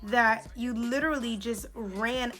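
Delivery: slow, emphatic retold retort; indignant comeback. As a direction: stretch out each accusation, retelling it pointedly. Retells her angry comeback to technician.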